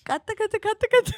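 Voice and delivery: loud, high, laughing; laughing shout. Real, not acted. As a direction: shout cut it through laughter. Laughing, calling to cut.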